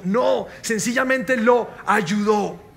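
slow, deliberate punch line; slow emphasis. Acted. Preacher correcting judgmental thoughts, slow and deliberate. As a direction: spell it out slowly, each word landing.

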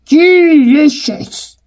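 slow, relishing big bad wolf; chortling wolf. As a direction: savour it like a greedy wolf. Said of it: "the wolf chortled".

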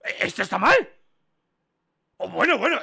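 high, loud indignation; incredulous protest. Real, not acted. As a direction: argue back loudly in disbelief. Protests being marked wrong again.

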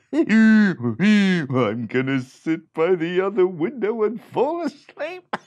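high, loud, slow braying while laughing; laughing donkey. A narrator's reading. Donkey choosing a window, braying high and laughing. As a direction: bray it through laughter. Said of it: "The donkey said".

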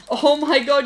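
ordinary talk, nothing marked

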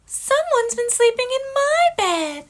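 sing-song like a fussy mama bear. Acted. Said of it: "And Mama Bear said".

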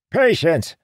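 put-on high, loud irritated old man; snapped. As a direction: snap it at him. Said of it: "the old man snapped".

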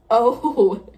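sudden loud gasp of an oh; startled oh. Real, not acted. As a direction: caught off guard, blurt it out. Sudden shock at the model falling.

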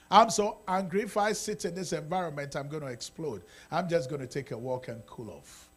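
normal talking voice

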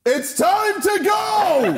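high, slow, loud call; excited shout. Acted. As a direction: announce it, stretched and shouting. Harry's abrupt exit line.

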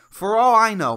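loud exclaim. Real, not acted. Loud burst.

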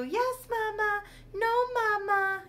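put-on very high-pitched baby doll voice; tiny high voice, drawn out at the end. Shown as a demonstration. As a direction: squeak it like a toddler answering mom. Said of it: "I don't wanna do a baby voice. I only do".

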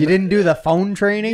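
high-pitched incredulous teasing; hammed-up taunting question; mock disbelief. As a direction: act scandalized to roast a rookie. Acting out coworkers roasting him.

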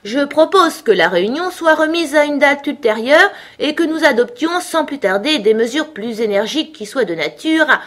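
high-pitched, speechifying dodo; solemn. A narrator's reading. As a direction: deliver a formal motion with ceremony. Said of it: "déclara solennellement le dodo".

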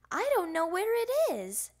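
high, loud complaint; little frog at a loss; childish protest. A narrator's reading. Child protests about lost net.

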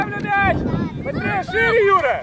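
loud coaching shout; yelling from the sidelines. Real, not acted. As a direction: call out across the field. Coach shouting at players on pitch. (крик с бровки)

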